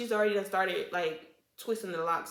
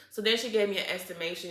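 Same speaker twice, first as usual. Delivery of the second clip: usual speaking voice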